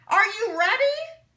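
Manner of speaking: loud, high rallying cry. A narrator's reading. Speaker rallying the quilting men, loud rousing call. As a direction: rouse the men, voice rising. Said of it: "His voice became louder".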